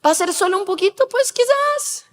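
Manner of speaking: hesitate, then let the pitch climb into a tentative maybe; wavering conscience. Retold or imitated. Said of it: "pero ahora está". (conciencia que duda, aguda y suave)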